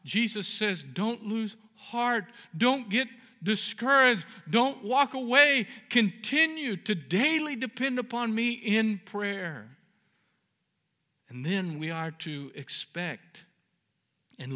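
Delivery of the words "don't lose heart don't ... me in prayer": rising exhortation. Acted. Quotes Jesus, building.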